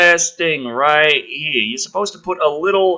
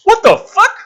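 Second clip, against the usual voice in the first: high, loud incredulous yelp; shocked outburst; 9 dB louder than usual. Acted. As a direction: blurt it out in disbelief. McKenna's line read out.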